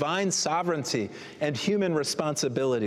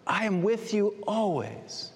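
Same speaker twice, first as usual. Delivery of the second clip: slow tender promise; 6 semitones higher, slower than usual. Acted. Voicing Jesus's promise.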